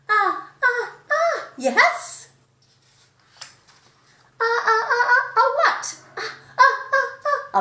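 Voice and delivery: rising, loud gasping ah ah before a sneeze; sneeze build-up. Acted. Gerald fighting a sneeze while Piggy guesses words, gasping build-up.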